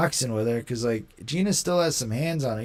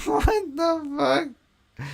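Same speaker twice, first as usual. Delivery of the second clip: high, drawn-out laughing what the fuck; cracking up in disbelief at the post; stretched-out incredulous laugh-line; 11 semitones higher, much slower than usual. Real, not acted. Reacting to a trash-talk post.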